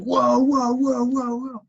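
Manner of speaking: loud, high string of whoas; alarmed crowd shout. Retold or imitated. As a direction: call out to break up a fight. Acting out crowd's alarm at scuffle.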